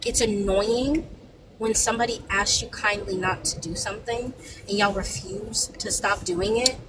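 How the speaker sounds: ordinary talk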